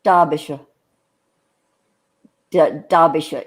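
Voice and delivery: careful low try at Derbyshire, DAH-bi-shuh; British pronunciation. Shown as a demonstration. Host practising after English guest, Derbyshire as DAH-bi-shuh. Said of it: "Is that close?".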